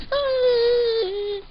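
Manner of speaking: high, held mock yelp; acted "that hurts" wail; cry of pain. Arm pulled, cries out.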